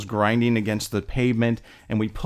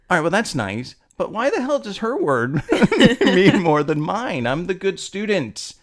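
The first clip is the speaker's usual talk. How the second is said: put-on indignant teen; 8 semitones higher, 4 dB louder than usual. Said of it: "and then I was like".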